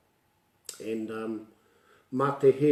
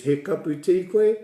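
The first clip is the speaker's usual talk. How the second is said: asking the elder; 6 semitones higher, 6 dB louder than usual. Retold or imitated. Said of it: "when I says".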